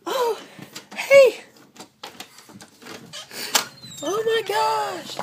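very high, cooing greeting to the dog. Real, not acted. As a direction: squeal hello at the pup.

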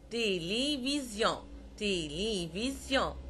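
تيليفزيون slowly, with "tay-lay" é sounds; slow, syllable-by-syllable French تيليفزيون; closed E. Shown as a demonstration. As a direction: stretch each é in تيليفزيون. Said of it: "وينطق مثل الإي في الإنجليش".

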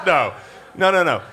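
ordinary talk, nothing marked

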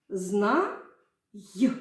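«Зна-й» with a pause before a short й; syllable then an added й sound; split with added sound. Shown as a demonstration. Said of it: "я зараз вам покажу".

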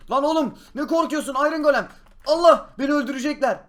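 high, loud frightened yelling; panicked yelling. Acted. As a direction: yell in panic. Scolding golem, fearing death.

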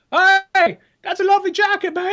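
high, loud cheery British taunt; hammed-up Cockney taunt; British mock compliment. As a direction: sneer a fake compliment in British. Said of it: "He's just like".

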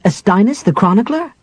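amazed half-elf; astonished. Acted. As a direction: disbelief rising on chronicler. Tanis astonished.

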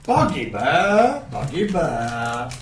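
slow, sing-song creature call; Pokemon voice. Acted. Game master voicing bugbears as Pokemon, slow cute chant. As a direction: say your own name like a Pokemon. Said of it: "all getting Pokemon voices".

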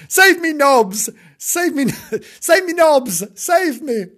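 high, loud, hammed-up cries for help; frantic plea. Kitten chased by dog begs Nobs, frantic. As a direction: call for rescue, laughter creeping in.

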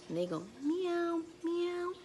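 high meowing; cat meow. Retold or imitated. Teacher to kids, high cat meow.

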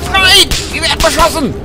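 high, loud yell under fire; panicked high-pitched cry; alarmed shout. Real, not acted. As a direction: shout out that you're being attacked. Alarm as they come under fire.

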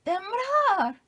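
loud, high cry of alarm; alarmed shout. Retold or imitated. Brother shouting about the earthquake. (grito fuerte y agudo de alarma)

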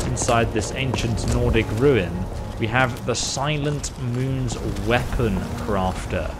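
usual speaking voice

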